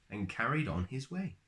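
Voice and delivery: plain reading voice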